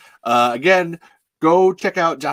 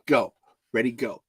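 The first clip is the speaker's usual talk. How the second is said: usual speaking voice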